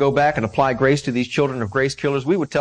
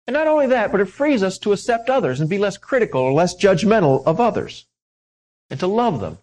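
higher, loud, fervent sermon voice; preaching at full force; 6 semitones higher, 3 dB louder than usual. Acted. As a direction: lift it up with conviction.

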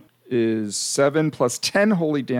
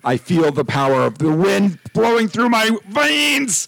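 big booming power-up declaration; dramatic power-up; 7 semitones higher, 4 dB louder than usual. Acted. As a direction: proclaim it like a transforming hero.

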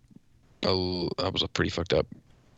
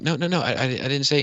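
lightly higher, flustered backpedal; mock flustered denial; 7 semitones higher, much faster than usual. Retold friend's wife denying to Laura, mildly high and flustered. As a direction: play the friend's wife backing down, a touch higher. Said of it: "she was like".

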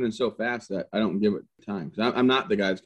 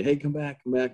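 retold yell; 6 semitones higher than usual. First sergeant calls his soldier back, mild mock yell. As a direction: imitate calling someone back, lightly. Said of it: "my first sergeant yelling at me".

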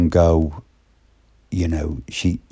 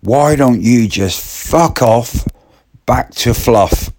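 high, louder slowed retort; exasperated put-down; 6 semitones higher, 4 dB louder than usual. Retold or imitated. Fed-up woman telling Martin off, high and scornful. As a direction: land the insult with relish. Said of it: "She said".

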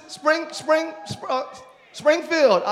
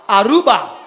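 loud Aruba with local accent, open "Ah-ROO-bah"; local pronunciation; 11 dB louder than usual. Shown as a demonstration.

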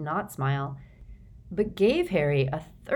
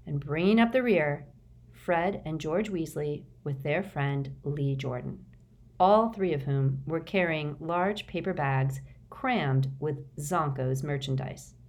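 usual speaking voice

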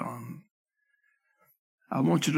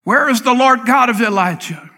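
loud, high cry to heaven; anguished shout; 6 semitones higher, 8 dB louder than usual. Acted. Grieving believer crying out to God, shouting.

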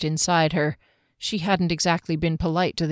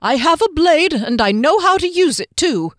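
much higher and louder, hammed-up bravado; blustering halfling; 9 semitones higher, 6 dB louder than usual. Halfling threatening Juggler with a little knife, much higher, loud bluster. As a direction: brandish a tiny knife with grand bravado.

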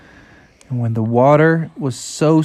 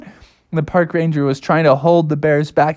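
ordinary delivery

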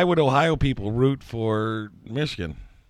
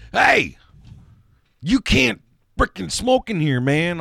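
louder, high-pitched mock yell; retold angry bar owner; 8 semitones higher, 5 dB louder, slower than usual. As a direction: call out a smoker, imitating an angry owner. Bar owner confronting smoker in retold story.

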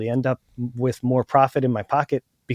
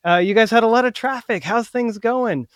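higher-pitched friendly small talk; friendly negotiation opener; 7 semitones higher than usual. Shown as a demonstration. Buyer making small talk with sellers, friendly and high. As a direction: chat them up, voice lifted. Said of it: "like this".

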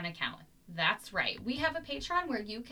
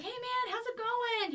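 put-on kid voice; slower than usual. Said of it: "he's like".

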